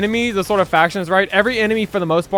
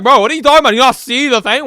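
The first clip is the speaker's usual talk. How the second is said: incredulous friend; 7 semitones higher, 7 dB louder, faster than usual. Retold or imitated. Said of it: "He's like".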